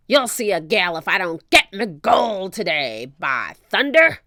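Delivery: loud pirate threat; shout. Acted. Captain shouts his threat, loud. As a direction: bellow at the crew. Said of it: "Captain Bones shouted".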